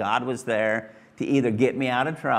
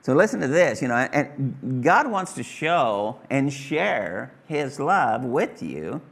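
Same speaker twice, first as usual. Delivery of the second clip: ordinary talk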